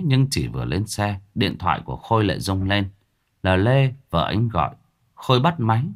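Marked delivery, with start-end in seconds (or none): none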